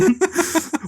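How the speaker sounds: a high burst of laughter. Real, not acted. Critic cracking up while mocking the Godard film, high laugh. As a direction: crack up mid-bit.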